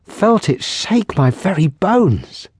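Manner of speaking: slow frightened. Acted. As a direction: let the fear linger on each word.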